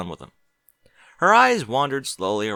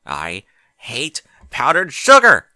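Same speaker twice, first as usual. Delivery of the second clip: loud, slow furious shout; drawn-out enraged yell; angry outburst; 4 semitones higher, 12 dB louder, slower than usual. Acted. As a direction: explode at the sugar mess. Exhausted Twilight furious about sugar.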